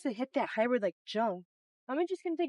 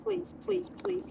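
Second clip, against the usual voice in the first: pleading with the ball; 8 semitones higher, 4 dB quieter, slower than usual. Real, not acted. Begging putt to drop.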